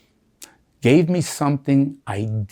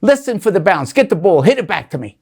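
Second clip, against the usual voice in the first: retold scream; 5 semitones higher, 7 dB louder than usual. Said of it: "and scream".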